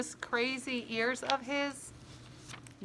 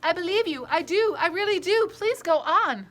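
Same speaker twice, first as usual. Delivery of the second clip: put-on higher, louder urgent pleading girl; pleading cry; 8 semitones higher, 5 dB louder than usual. Sophie begs the offended giant to keep going, pleading cry. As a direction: beg him to believe her, almost crying out. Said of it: "Sophie cried".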